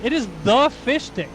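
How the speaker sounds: "the" punched loud and high before Fish Stick; stress on THE. Real, not acted. Telling the guys who this is, stress on THE. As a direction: lean hard on "the", as in the one and only.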